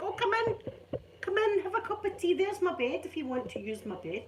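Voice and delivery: high, sing-song welcoming voice to a foreign guest; welcoming host. Retold or imitated. Scot welcoming a foreign visitor in, high and eager. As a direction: hospitable Scot, pitched high and inviting. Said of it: "We're all like".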